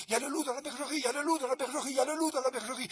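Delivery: alerte criée, aiguë et forte; chien qui hurle. Acted. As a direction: crie l'alarme de toutes tes forces. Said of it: "il se met à hurler". (le chien de garde crie l'alarme)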